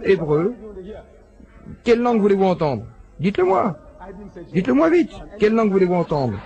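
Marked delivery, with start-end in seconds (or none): 1.8-6.4 eager challenge. Real, not acted. Lecturer challenging the audience to name a language, eager. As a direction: pitch climbing higher, pressing the crowd.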